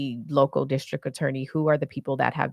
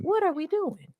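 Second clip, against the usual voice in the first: exasperated; 13 semitones higher, 4 dB quieter than usual. Real, not acted. Exasperated outburst about inaction.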